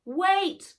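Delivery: loud call after the dog. A narrator's reading. Girl calling after the wolf, loud call. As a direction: shout wait down the mountain. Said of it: "called Nat".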